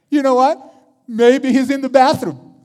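loud, high-pitched jeering; Elijah, mocking. Preacher as Elijah ridiculing Baal to his prophets, mocking. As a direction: taunt them with a ridiculous suggestion. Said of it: "He began to mock them, like, really mock them".

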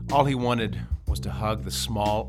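usual speaking voice

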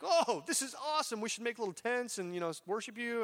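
high, bubbling excitement; mock-thrilled Peter, pitched high; excited Peter. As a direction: gush like an overeager disciple.

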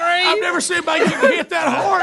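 laughing shout. Real, not acted. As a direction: yell it while cracking up. Host cracking up at clip.

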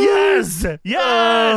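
loud, high cheering; excited shout. Real, not acted. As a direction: yell it out in delight.

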